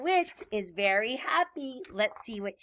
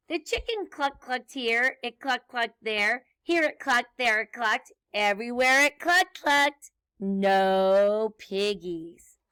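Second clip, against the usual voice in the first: bouncy, rhythmic refrain narration; story read to children; 5 dB louder than usual. A narrator's reading. Reader recites animal noises for children, bouncy storytelling. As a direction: read it with a nursery-rhyme bounce. Reads picture book, singsong refrain.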